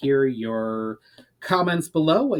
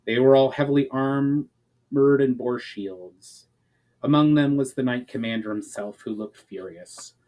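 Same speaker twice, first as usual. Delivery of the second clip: plain reading voice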